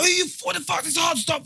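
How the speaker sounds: retelling a blow-up at police officers, high and heated; going mad at police. Retold or imitated. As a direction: lose it at the cops, pitched right up. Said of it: "just went mad".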